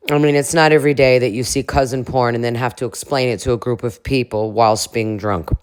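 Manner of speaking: usual speaking voice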